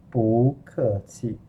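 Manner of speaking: slow teaching model of a phrase; slowly demonstrated pronunciation. Shown as a demonstration. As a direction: draw each syllable out for learners. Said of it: "I can say it very slow".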